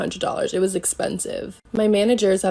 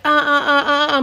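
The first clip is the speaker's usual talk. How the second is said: high, rapid "ah-ah-ah" squabble; mock bickering noise; 5 semitones higher, 4 dB louder than usual. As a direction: imitate two people yapping at each other. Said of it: "going back and forth at each other".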